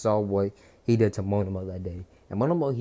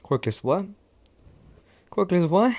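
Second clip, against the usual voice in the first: mocking challenge, building; 8 semitones higher, 5 dB louder than usual. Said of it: "Deku pointed the blade at him, saying".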